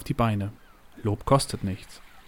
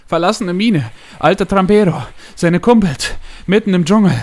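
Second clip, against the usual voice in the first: loud, high bursts of words; short blurted answers; 8 semitones higher, 10 dB louder than usual. A narrator's reading. Winded secretary answers the boss's questions, blurting in bursts. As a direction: push out each fragment like a breathless shout. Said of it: "kurze Antworten hervorstoßen".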